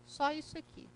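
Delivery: normal talking voice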